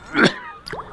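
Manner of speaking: put-on hacking drunken cough; raspy coughing fit; acted cough. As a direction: cough it out like a sick man. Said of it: "How long have you had that cough?".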